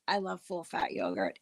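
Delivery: quiet confiding repeat of "I love full fat yogurt". Real, not acted. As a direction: murmur it again to yourself.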